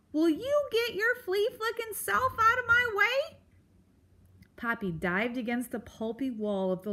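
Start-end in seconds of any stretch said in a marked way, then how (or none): 0.1-3.4 high, loud cranky bellow; bellowing porcupine. A narrator's reading. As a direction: bellow it down the log. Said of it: "Aerith bellowed".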